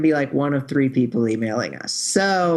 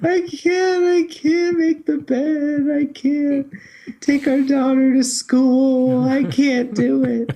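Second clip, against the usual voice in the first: much higher, slow, wailing mock sobs; Robert crying impersonation; 9 semitones higher, 3 dB louder, slower than usual. To his co-host, mimicking Robert crying too hard to do chores, hammed-up high wailing. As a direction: ham up a husband too weepy to do chores. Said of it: "just a Robert crying impersonation".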